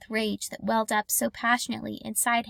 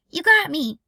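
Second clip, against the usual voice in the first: louder, higher, playfully hit; playful call; 5 semitones higher, 8 dB louder than usual. Acted. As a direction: pretend to be shot, calling out. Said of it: "she called".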